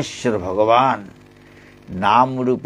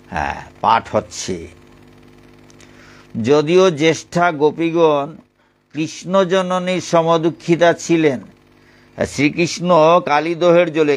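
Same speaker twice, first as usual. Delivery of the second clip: louder, higher reading-aloud voice; scripture reading; 5 semitones higher, 4 dB louder than usual. Acted. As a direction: read the holy text out to listeners. Reads the Bhagavatam commentary aloud.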